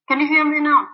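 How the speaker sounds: calling out on a bad line; high and loud, checking she's heard. Real, not acted. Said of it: "Talk louder".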